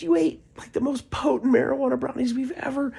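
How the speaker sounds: put-on very high, loud girl voice; hippie girl. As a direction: blurt it out high and amazed. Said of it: "She goes".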